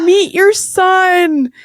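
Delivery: very slow, high, moved voice; tender announcement. Retold or imitated. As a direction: savour every word for the new mother. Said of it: "You just hear Adam go".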